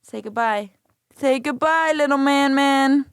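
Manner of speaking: put-on baby talk. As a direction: coax a baby, high and sing-song. Coaxing baby to say bye.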